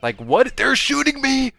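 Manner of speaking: high, loud disbelieving outburst; panicking gamer. Real, not acted. Being shot, panicking gamer.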